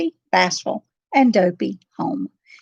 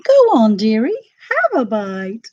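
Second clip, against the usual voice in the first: coaxing hag; 3 semitones higher, 6 dB louder than usual. A narrator's reading. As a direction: coax her to bite the apple. Hag offers apple.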